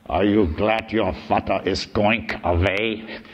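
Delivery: slow imitation of a heavy Viennese accent. Psychoanalyst quizzing a five-year-old boy, heavy Viennese accent. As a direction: drag the question out in a thick Viennese accent. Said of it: "said in a heavy Viennese accent".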